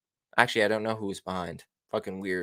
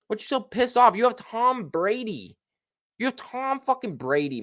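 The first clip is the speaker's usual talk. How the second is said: incredulous rant; 10 semitones higher than usual. Real, not acted. Ranting at Bruce Arians.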